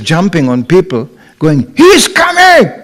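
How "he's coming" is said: high, elated crowing call; excited cry. Retold or imitated. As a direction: cry it out like wild good news. Devotees jumping on people, exulting.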